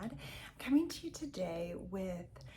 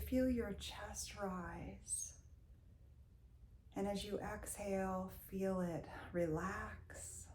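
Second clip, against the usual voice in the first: guided meditation; 7 dB quieter than usual. Real, not acted. Leads a Reiki body-scan meditation.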